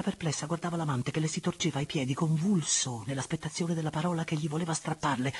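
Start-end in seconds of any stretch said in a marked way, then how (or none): none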